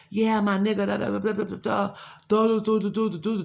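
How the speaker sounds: high, loud nonsense babble mimicking her talk; mock her with a rapid-fire sing-song; exaggerated high-pitched gibberish impression; mocking imitation.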